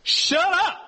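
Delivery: very high, sharp comic cut-off; God silencing the disciples, high and abrupt; God cutting in. Retold or imitated. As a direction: snap it in a squeaky comic voice. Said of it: "God goes".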